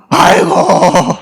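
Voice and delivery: long drawn-out aggrieved 아이고; slow groaning 아이고 of frustration; exasperated sigh. Real, not acted. Pastor groaning in frustration at the congregation, drawn out. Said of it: "억울하더라고요".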